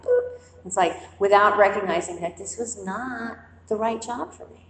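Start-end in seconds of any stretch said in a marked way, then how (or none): 0.0-0.4 a sudden high squeaky boop; playful boop. Retold or imitated. Caving in to the job offer with a squeaky boop. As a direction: pop out a tiny high boop. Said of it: "And I went like".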